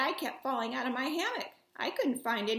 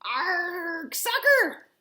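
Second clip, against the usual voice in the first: pirate yell; 3 semitones higher, 6 dB louder, much slower than usual. Acted. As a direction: kick the ball and roar.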